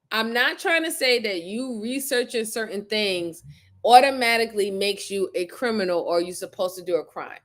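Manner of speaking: usual speaking voice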